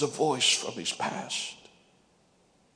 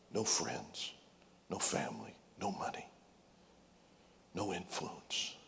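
low and hushed; 5 semitones lower, 7 dB quieter than usual. Acted. Preacher listing David's losses.

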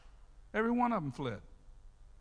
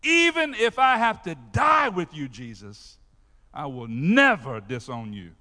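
loud, insistent vow; emphatic insisting; 10 dB louder than usual. Retold or imitated. Peter insisting to Jesus he will never leave, loud. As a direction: press the promise hard, insisting. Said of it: "insisted emphatically".

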